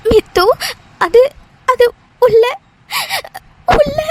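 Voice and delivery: broken words between sobs; acted sobbing. Crying friend trying to explain what happened inside, tearfully. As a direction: struggle to speak while crying. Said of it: "எதுக்குடி இப்படி அழுதுட்டு இருக்க".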